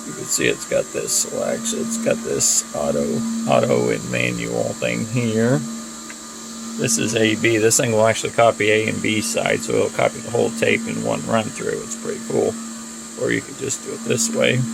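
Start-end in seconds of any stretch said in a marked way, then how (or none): none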